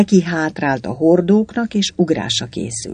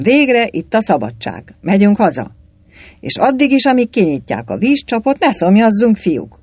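raised, louder, celebratory address; rallying shout; 6 semitones higher, 4 dB louder than usual. A narrator's reading. Galamb cheering soldiers on toward the rum, rallying shout. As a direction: rouse the crowd to celebrate freedom. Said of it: "kiáltotta Galamb".

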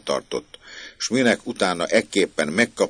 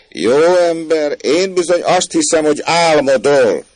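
mocking dismissal; 12 semitones higher, 10 dB louder than usual. Maid tells the man he is dreaming, mockingly dismissive. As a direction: call down as if to a fool.

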